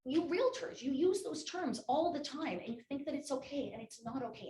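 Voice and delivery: high, loud indignant accusation; retold angry scolding. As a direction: act out an upset client telling someone off. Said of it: "she was so angry with me".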